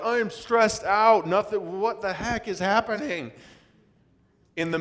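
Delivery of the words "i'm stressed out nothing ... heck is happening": high-pitched acted panic; hammed-up frantic wail, pitched way up; stressed-out panic. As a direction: voice climbs as if everything is falling apart. Acts out a stressed-out person.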